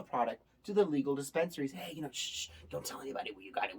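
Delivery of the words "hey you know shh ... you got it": soft, hushed seller; hushed secret. Retold or imitated. A shady seller telling the buyer to keep quiet, hushed. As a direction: hush it like a shady deal.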